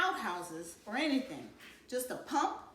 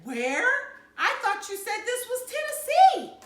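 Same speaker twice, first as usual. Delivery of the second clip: loud high frightened outburst; panicked cry; 13 semitones higher, 11 dB louder than usual. Acted. Scared kid blurting out to Mama at the dark rest stop, loud and high. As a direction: blurt it out in alarm, much higher and louder.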